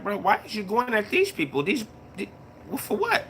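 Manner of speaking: retold baffled protest, voice climbing; puzzled retold challenge.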